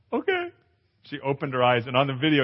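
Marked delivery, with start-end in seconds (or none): 0.1-0.5 much higher, small reluctant okay; a tiny high frightened yes; scared wife voice. Retold or imitated. Wife agreeing to open her eyes, high and timid.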